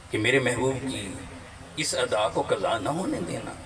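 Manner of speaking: ordinary talk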